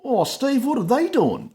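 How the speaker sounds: high, loud voicing of the Aussie mate. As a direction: squeak out his question in a mock Aussie voice. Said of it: "then he goes".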